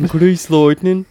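high, loud hammed-up Brummie; hammy Brummie through laughter; Brummy accent. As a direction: belt it in a mock Birmingham accent. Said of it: "Brummy Grease".

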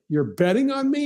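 can't believe it, pitch shoots up; disbelief. Retold or imitated. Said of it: "And I'm like".

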